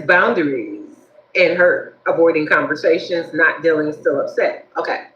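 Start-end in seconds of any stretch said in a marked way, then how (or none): none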